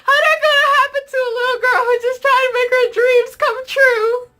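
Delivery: high, loud hammed-up crying whine; mock dramatic wail. A girl mourning her ruined fan photo, in a hammed-up wail. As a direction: wail like a tragic heroine.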